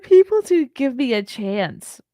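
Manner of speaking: a hint of a whiny mock Henyard; mocking quote. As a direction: parody her pleading.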